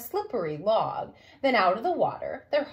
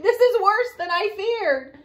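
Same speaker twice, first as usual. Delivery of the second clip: frightened; 8 semitones higher, 6 dB louder than usual. Acted. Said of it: "Daddy cried".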